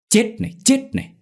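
high shrill beating yell; vicious yell. A narrator's reading. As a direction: spit it out while kicking. Woman beats Dậu.

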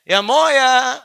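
high, loud, fond call to mother; affectionate call. Shown as a demonstration. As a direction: call her sweetly like a child. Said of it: "Panggilan sayangnya itu muya".

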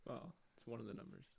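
fast, soft correction; quiet aside. Real, not acted. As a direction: mutter it quickly aside.

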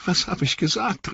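high, remorseful exclamation; anguished cry. A narrator's reading. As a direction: cry out in tearful horror at yourself. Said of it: "rief er".

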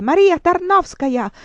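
high, loud shriek of the name; shrieked into her ears. Acted. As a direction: newsboys yelling right in her ear. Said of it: "mi strillano nelle orecchie".

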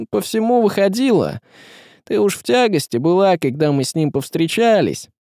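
lightly voiced high, plaintive figure; confessing tsar. Tsar explaining his bargain to his wife, confessing.

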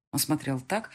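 usual speaking voice

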